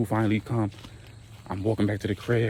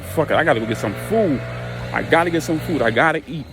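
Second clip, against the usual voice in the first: urgent; 5 semitones higher, 6 dB louder, faster than usual. Retold or imitated. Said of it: "So I'm like".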